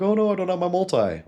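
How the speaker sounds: high, loud, put-on panic; mock freak-out. Someone pretending to freak out over missing vitamins, mock panic. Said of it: "freaking out, like".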